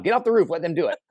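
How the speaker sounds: mock self-scolding. Inner voice scolding himself.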